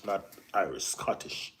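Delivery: ordinary delivery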